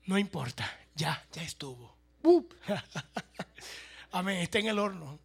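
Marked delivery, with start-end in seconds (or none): 2.2-2.5 loud, high sudden bup; sharp bup. Real, not acted. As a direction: pop it out loud. Sudden exclamation in sermon. (bup fuerte y agudo)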